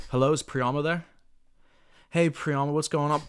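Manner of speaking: nervous, hesitant phone greeting; timid caller. Shown as a demonstration. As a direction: sound like an unsure cold caller. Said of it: "This is how most people are gonna talk on the phones".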